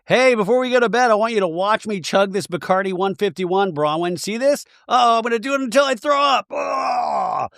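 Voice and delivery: high, rushed, over-excited mock party girl; hammed-up eager boasting that ends in a retch; cartoonishly keen, racing high voice; imagined party drunk. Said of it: "in Meredith's head, Brittany was like".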